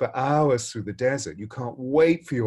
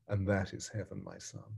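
gentle revelation; 3 semitones lower, 9 dB quieter than usual. Retold or imitated. Wise man's gentle final lesson.

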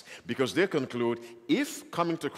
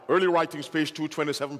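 usual speaking voice

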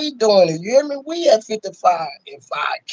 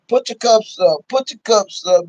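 normal talking voice

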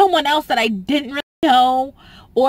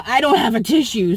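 ordinary talk

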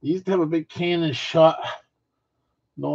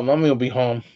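ordinary talk

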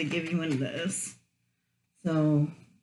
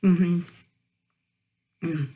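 muffled closed-mouth hum; speaking between bites; mouth full while chewing. Real, not acted. Seen 1: Closed lips, chewing after earlier bites; fingers at mouth, burger in front.

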